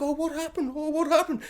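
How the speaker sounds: high, soft feigned innocence; soft, high, faux-innocent Tom; mock-innocent Tom. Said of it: "He's like".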